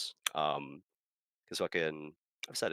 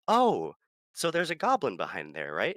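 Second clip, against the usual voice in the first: loud, higher quick guess; eager interruption; 8 semitones higher, 8 dB louder than usual. Retold or imitated. Player cutting off the DM, excited. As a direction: blurt it out eagerly. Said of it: "he cuts me off".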